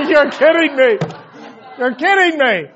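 retold exasperated astonishment, high and loud; incredulous dad exclaiming; loud disbelief. Father retelling his disbelief.

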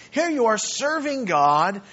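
ordinary talk, nothing marked

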